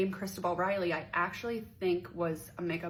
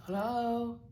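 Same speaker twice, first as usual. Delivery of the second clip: slightly higher, unbothered put-on hello; mild mock hello in his voice; imitating the caller; 5 semitones higher than usual. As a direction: answer as the caller, much less forceful. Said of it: "And he's like".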